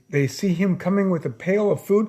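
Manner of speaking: usual speaking voice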